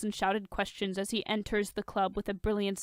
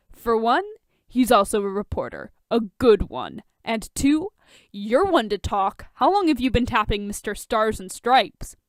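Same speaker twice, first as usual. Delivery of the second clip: retort; 7 dB louder than usual. Acted. Drunk Bruce retorting.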